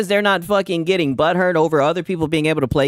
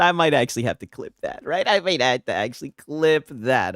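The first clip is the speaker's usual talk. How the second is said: usual speaking voice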